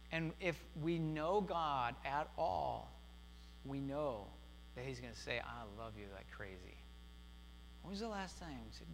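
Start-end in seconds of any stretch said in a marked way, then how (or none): 5.4-6.8 soft, low, hushed loving reply; tender voice of God. Acted. Pastor voicing God's answer to the listener, soft and tender. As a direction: give God's answer gently, almost under breath.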